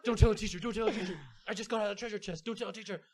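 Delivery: urgent, repeated plea, played for laughs; panicked pleading. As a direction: frantically beg them not to tell. Panicked plea after slapping crush.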